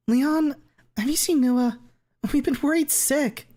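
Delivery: put-on very high, worried girl; shrill concerned schoolgirl. Tiffany voiced high.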